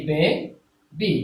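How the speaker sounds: Baby drawn out very slowly into two separate beats; counting out baby syllable by syllable. Shown as a demonstration. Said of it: "তাহলে এক, দুই".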